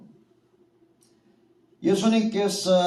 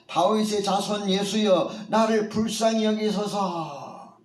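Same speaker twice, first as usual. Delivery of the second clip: pleading cry, pitched higher than before; louder cry for mercy; 5 semitones higher than usual. A narrator's reading. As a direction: call out to Jesus over the crowd, begging. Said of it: "더 크게 소리를 지릅니다". (맹인이 예수께 더 크게 외치는 간절한 부르짖음)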